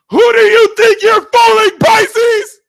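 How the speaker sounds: high, loud shrieking accusation; shrieking yell. Real, not acted. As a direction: scream it at them, half laughing. Yelling at Pisces.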